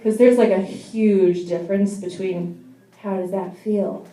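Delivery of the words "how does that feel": a soft bedroom murmur; sexy voice. Shown as a demonstration. To a partner in bed, in a soft sexy voice. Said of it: "the sexy voice".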